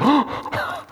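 imitated sharp gasp; big acted intake of breath; child's awed gasp. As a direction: gasp like an astonished toddler. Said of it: "their faces are just like".

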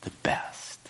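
hushed, almost conspiratorial; whispered punchline. Retold or imitated. Master of ceremony confiding to the groom, whispered. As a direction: drop to a secret whisper for the punchline.

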